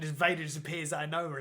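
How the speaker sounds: ordinary talk, nothing marked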